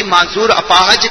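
usual speaking voice

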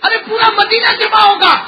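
loud, high, tearful outburst; tearful cry. Acted. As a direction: break out in grief. Ali protests, preacher voicing him.

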